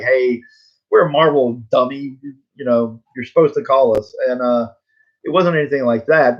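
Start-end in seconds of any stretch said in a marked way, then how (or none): none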